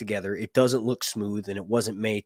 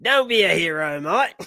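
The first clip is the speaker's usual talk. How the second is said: broad Australian, pitched up, questioning lilt; Australian interviewee impression; 6 semitones higher, 5 dB louder than usual. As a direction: tell it as a hammed-up Aussie storyteller. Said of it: "this guy right here being interviewed".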